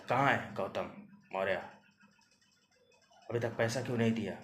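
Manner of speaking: loud, fast angry yell; shouting demand. Acted. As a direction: yell it so the neighbours hear. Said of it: "इतना ज़ोर से मत चिल्लाइए".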